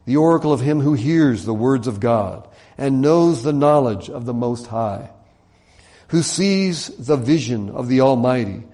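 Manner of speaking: emphatic, measured oracle recital; scripture reading. Acted. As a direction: proclaim the verses phrase by phrase. Reads scripture aloud.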